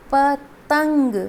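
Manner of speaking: पतंग drawn out very slowly, each sound held; slow drawn-out word. Shown as a demonstration. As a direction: stretch पतंग out to show the nasal ंग. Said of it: "क्योंकि त तब तक पूरा नहीं होगा".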